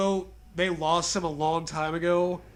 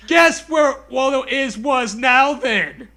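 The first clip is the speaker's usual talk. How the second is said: louder shouted taunt, the last words slow; in character shout; 6 semitones higher, 9 dB louder, slower than usual. Acted. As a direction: shout the creepy line again for effect. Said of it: "I'm saying in character".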